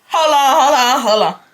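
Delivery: loud, high, repeated interruption; hammed-up shock, starting high and loud; flabbergasted. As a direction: call a halt in disbelief. Acts out shock at request.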